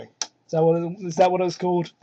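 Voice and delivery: ordinary delivery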